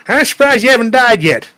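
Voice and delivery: high, hollering country relative; imitated rowdy country cousin. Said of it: "my cousin Muff always says".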